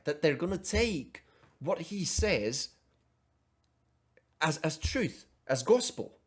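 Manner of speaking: ordinary talk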